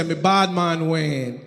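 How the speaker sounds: bad man Wayne persona. Comic introduces his fake island persona, low mock Jamaican tough voice. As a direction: drop low and growl the patois name. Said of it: "So me say".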